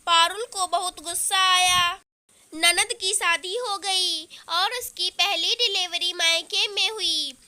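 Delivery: plain reading voice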